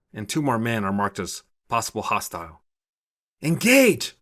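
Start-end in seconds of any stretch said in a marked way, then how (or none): 3.4-4.2 high, loud shouted command; yell. A narrator's reading. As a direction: shout the order hard. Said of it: "she yells".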